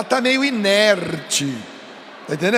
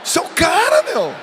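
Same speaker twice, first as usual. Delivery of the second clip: excited shout; 7 semitones higher than usual. Shown as a demonstration. Preacher showing the youth the right tone, excited shout. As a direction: shout it up high like a cheer. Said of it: "com a mesma entonação que eu tô dizendo".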